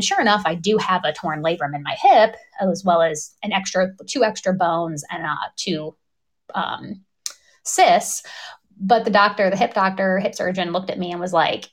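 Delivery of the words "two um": ordinary delivery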